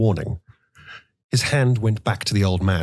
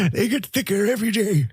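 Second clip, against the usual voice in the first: put-on much higher, thin old man's falsetto; thin falsetto complaint; 13 semitones higher than usual. A narrator's reading. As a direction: whine it in a frail falsetto. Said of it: "he complained in a thin, undependable falsetto".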